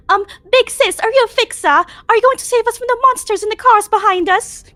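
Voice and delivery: put-on high, bright child asking eagerly; high little girl voice. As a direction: play a small excited kid. Voicing little girl passenger.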